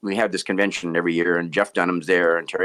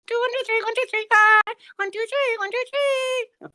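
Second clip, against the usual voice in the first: very high little chimp puppet voice; squeaky-high puppet count-in; Johnny. Acted. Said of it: "Johnny, be".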